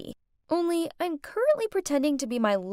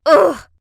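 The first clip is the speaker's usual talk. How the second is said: loud, higher exasperated "ugh"; frustrated groan; 5 semitones higher, 13 dB louder than usual. Acted. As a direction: let out a big groan of frustration. Narrator groans at her dilemma.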